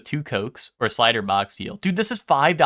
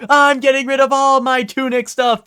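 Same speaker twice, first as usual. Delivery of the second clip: much higher, louder mock tearful pirate; Patchy the Pirate sobbing; 9 dB louder than usual. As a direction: whine it through cartoon tears. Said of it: "Fucking tearing up".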